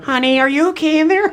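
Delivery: high, sing-song mom calling through a door; a mother knocking, asking if her son is okay, in a high mock voice; worried mother. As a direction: call in like a concerned mother outside the bathroom. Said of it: "Mother's like knocking on the door".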